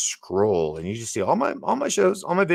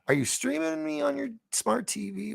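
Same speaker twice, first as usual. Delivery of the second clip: silly voice; 3 dB quieter than usual. Pretend. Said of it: "doing different voices".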